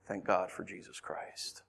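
low, slow, soft voice; hushed thanks. Real, not acted. Preacher thanking God after lament, hushed. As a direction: give thanks softly and slowly.

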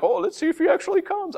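high scoffing taunt; jeering crowd. Retold or imitated. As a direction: sneer it like a scoffer. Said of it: "they're like".

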